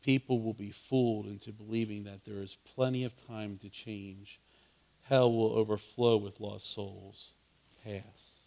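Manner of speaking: soft, low and hushed, still voiced; lightly played conspirator's murmur, dropping away at phrase ends; whispering Satan. A narrator's reading. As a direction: read it like a quiet, secret scheme. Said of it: "whispered Satan".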